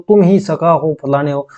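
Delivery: usual speaking voice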